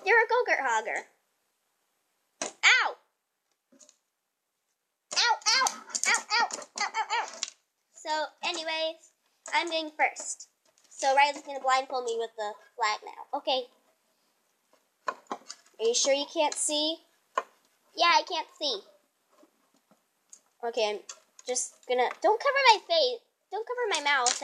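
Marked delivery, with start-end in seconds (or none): 2.6-7.3 high yelps of pain, over and over; crying out in pain. Real, not acted. Cries out in pain. 22.2-24.4 protesting. Real, not acted. Protesting the blindfold.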